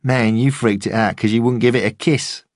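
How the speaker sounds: mocking lad on a bench; jeering mate. A narrator's reading. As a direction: taunt the dog owner for a laugh. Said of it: "said one of his mates".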